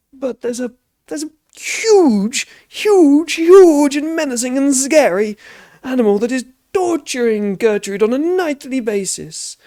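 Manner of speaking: high, slow, loud spooked farmer; Balbo Bunce dramatic. Acted. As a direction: build up the scary animal. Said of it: "I am Balbo Bunce".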